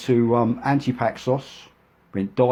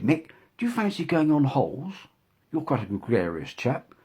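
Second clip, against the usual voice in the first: imitating a posh woman, higher voice; posh lady; 6 semitones higher than usual. Shira inviting Mick on holiday, posh imitation. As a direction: put on a plummy posh lady. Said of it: "she was quite posh".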